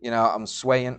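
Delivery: ordinary talk, nothing marked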